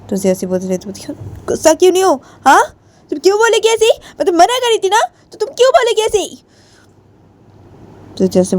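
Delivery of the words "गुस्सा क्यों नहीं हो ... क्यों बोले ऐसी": high-pitched indignant scolding; angry. Acted. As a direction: demand an answer, voice pitched high. गुस्सा क्यों नहीं हो. (गुस्से भरी ऊँची आवाज़)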